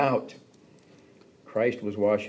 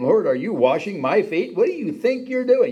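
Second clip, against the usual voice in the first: incredulous protest; 8 semitones higher, 4 dB louder than usual. Acted. Peter protests Jesus washing feet.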